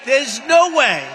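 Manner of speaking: slow, loud, high boast; cocky shout. Retold or imitated. Recalling his boast about Iowa to advisers, slow loud shout. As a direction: bellow it with swagger.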